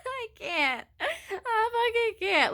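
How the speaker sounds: high, slow, broken sobbing voice; imitating bawling. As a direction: blubber it out as if in tears. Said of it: "Like, I was bawling".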